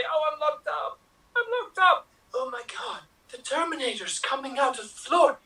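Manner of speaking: high, crying alarm at being locked up; a hammed-up frightened cry; mock panic. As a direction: wail like a trapped heroine. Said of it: "you are going to be Linda Hamilton".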